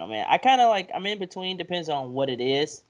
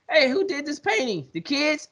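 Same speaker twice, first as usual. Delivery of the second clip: high, surprised question; 12 semitones higher than usual. Retold or imitated. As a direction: ask it up high, amazed. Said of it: "he said".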